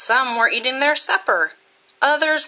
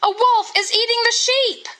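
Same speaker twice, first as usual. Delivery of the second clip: put-on boy crying out; 13 semitones higher than usual. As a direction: yell it high like a boy faking panic. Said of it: "he cried".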